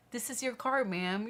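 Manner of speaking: high, loud and beaming, pushing the key; eager attendant. Retold or imitated. Parking attendant insisting to the owner, high and eager. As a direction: play the delighted attendant handing over keys. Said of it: "trying to shove it into my hand".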